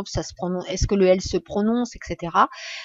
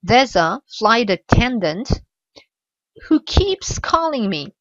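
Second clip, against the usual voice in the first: slow model reading; slower than usual. Shown as a demonstration. Teacher demonstrates the segmented sentence slowly. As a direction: stretch it out so learners can follow. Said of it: "donc vous voyez l'exemple".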